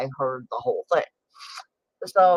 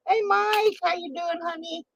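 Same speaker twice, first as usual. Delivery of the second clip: high, fading sweet hello; sweet greeting; 13 semitones higher than usual. Real, not acted. To Mike joining the stream, a high sweet greeting. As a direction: coo a hello to a regular.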